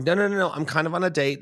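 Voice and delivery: high, quick string of protests; acted-out hasty brush-off; retold flustered refusal. Retold fending off a kiss.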